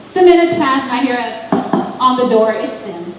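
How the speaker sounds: usual speaking voice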